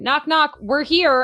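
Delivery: mock announcing arrival. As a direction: pretend to turn up at someone's door. Acting out showing up at door.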